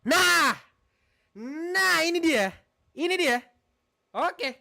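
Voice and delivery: high and loud, a burst of relief; finally got it working, cheer it out; excited relief. Real, not acted. Streamer cheering to viewers when the stream finally works, excited and loud.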